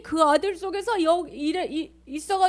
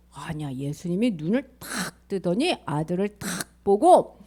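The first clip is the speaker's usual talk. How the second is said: ordinary delivery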